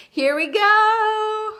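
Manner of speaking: high, loud and drawn out slowly; excited launch. Real, not acted. Host kicking off the writing moment, thrilled and stretched out. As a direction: savour the start like a countdown.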